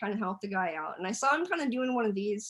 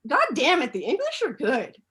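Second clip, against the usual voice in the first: mock outrage; 5 semitones higher, 6 dB louder than usual.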